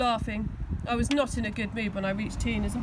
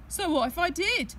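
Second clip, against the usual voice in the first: cocky; 6 semitones higher than usual. A narrator's reading. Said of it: "he said, cockily".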